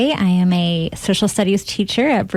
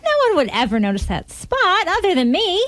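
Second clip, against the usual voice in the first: high, sweet, doting voice; dotty wife; 10 semitones higher than usual. Acted. Wife sweetly misunderstands her husband, high and doting. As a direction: coo at him without listening.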